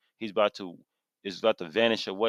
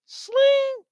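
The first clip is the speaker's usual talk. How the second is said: high sharp swish of a blade, much higher and louder; sword swish; 7 dB louder than usual. Pretend. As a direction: slice the air with the word. Imitates a sword sound.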